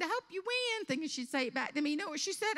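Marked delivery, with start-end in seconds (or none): 0.0-0.9 high, gushy pageant voice; hammed-up sweet lie; fake well-wishing. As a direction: squeal the wish with put-on sincerity. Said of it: "I looked at her and I said".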